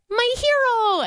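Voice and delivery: high, sing-song "my hero"; cooing "my hero". Retold or imitated. Owner cooing a pet name at her rescue dog, high and doting. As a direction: coo it up high like baby talk. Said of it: "every single day I go".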